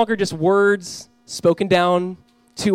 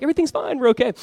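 high-pitched mock-innocent kid; imitated child pretending all is well; feigned innocence; 8 semitones higher, faster than usual. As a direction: cover up quickly in a high voice. Kids caught fighting feign innocence.

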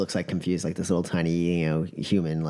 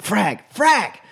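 rising frustrated outbursts, louder the second time; frustrated cursing; 13 semitones higher, 7 dB louder than usual. Acted. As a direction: swear while pacing, getting more upset. Pacing dwarf cursing repeatedly.

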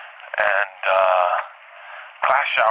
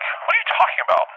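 mock high Chelsea voice. Chelsea voiced in retold dream.

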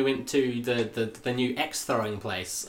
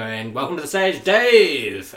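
announcer; 3 semitones higher, 8 dB louder, slower than usual. Retold or imitated. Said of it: "and they go".

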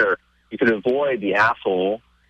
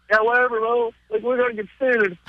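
pitched higher, brushing him off; dismissive doubt; 12 semitones higher than usual. Retold or imitated. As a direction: wave off the friend's promise, unconvinced. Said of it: "I'm like".

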